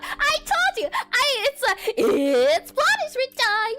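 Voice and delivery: high screechy villain mascot voice; Monokuma. Retold or imitated. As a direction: screech it out, stretching the final words. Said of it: "the Monokuma impression is also suspiciously spot on".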